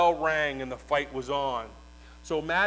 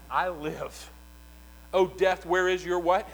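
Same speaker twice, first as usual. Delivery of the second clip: ordinary talk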